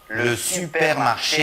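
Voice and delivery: supermarché with a rising, stretched last syllable; long final syllable. Shown as a demonstration. Teacher models Le supermarché with long ending. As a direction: hold the end of supermarché. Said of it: "La dernière syllabe du groupe rythmique est toujours plus longue".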